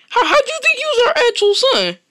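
high put-on mom voice, baffled; a shrill-voiced mother who can't believe her son's question; incredulous mother. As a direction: squeak out the disbelief like a shocked mom.

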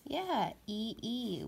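E E said slowly and clearly; teacher names the digraph; letter names. Shown as a demonstration. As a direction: spell out E E letter by letter. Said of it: "This is called digraph E E".

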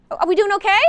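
pumped-up call to the class. Real, not acted. Teacher repeats question louder.

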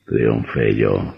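low, slow and satisfied; slow gloat. Acted. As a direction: savor the victory. Vampire gloats over the killing.

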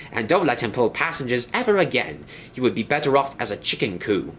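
a fussy railway inspector laying down the law; high-pitched stern inspector. Acted. Inspector continues.

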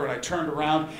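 normal talking voice

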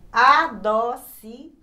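"adocicado" loud, in pieces a, do, ci, ca, do; separated syllables. Shown as a demonstration. Teacher reading an exercise word, syllable by syllable. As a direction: project each syllable of "adocicado". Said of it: "Vamos ver como é que separa".

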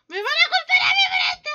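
very high, loud, fast squeal; hysterical shriek. Pretend. Player fearing blame for the mistake, hysterical shriek. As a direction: shriek it at top speed in comic panic. Repeats blame fear, far higher.

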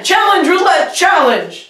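high, slow, dramatic title call; announcer voice. Shown as a demonstration. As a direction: announce it like a show host. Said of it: "Let's do it again".

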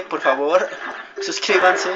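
suplica riendo y más fuerte; ruego entre risas. Real, not acted. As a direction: pide suscripción entre carcajadas. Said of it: "me dejé llevar". (ruego riendo)

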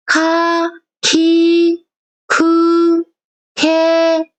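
카, 키, 쿠, 케 read high and separate; clear voiceless sounds. Shown as a demonstration. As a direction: model 카 키 쿠 케 crisply. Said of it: "이렇게 발음하던 청음을".

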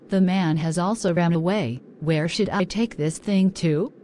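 ordinary delivery

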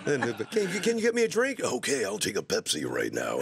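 creepy date talking in and out with his voice. Retold or imitated. Creepy date on the sketch, talking in and out with his voice. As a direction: voice swinging in and out like a creepy movie date. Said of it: "talks basically in and out with his voice".

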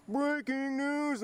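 Mister Goodman impression. Showing off his Mister Goodman, slow anchor voice. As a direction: draw it out like a news anchor. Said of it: "I can do a mean Mister Goodman".

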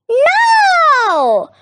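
high, loud witch's cry. A narrator's reading. Witch refuses the hungry dragon, crying out high and loud. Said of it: "cried the witch".

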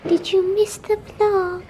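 ordinary delivery

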